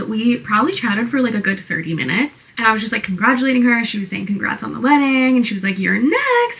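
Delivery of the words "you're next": high-pitched, mock-excited exclamation; excited teasing quote. Coworker congratulating her on the wedding, imitated in high excitement. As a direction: imitate someone gushing, pitched way up.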